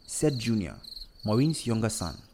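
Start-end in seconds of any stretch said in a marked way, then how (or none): none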